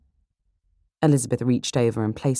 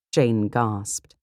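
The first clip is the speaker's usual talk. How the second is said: usual speaking voice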